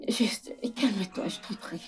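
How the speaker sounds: slow, whispery soothing plea; pleading. Acted. Wife begging husband to calm down, pleading softly. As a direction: beg him softly, almost in a whisper.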